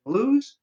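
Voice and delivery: "lose." punched high and loud, said lose with a z sound; stressed correction. Shown as a demonstration. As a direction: hit "lose." hard, high and loud as the right spelling. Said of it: "You always have to check for loose".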